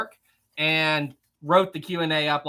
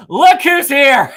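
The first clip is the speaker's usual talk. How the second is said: slow, loud words pushed out through laughter; high and loud, drawn out while cracking up; laughing; 10 semitones higher, 15 dB louder, much slower than usual. Real, not acted. Streamer greets a prank donor, laughing loudly.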